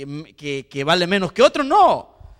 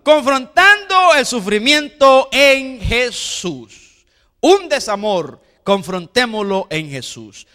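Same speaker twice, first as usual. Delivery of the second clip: slow preaching emphasis; 3 dB louder, slower than usual. Acted. Preacher stresses key phrase slowly.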